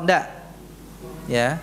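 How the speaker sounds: ordinary talk, nothing marked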